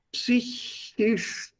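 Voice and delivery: psychische drawn out slowly, syllable by syllable; sounded out slowly. Shown as a demonstration. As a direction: stretch psychische into psy, chi, sche. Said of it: "And then comes the Sh".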